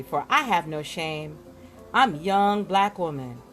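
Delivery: ordinary talk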